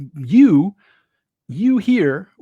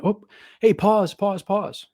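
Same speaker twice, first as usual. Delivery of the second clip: higher, mock call to halt; interrupting himself; 4 semitones higher, slower than usual. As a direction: act out halting a whole event mid-sentence. Acting a speaker halting his talk.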